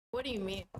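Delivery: ordinary delivery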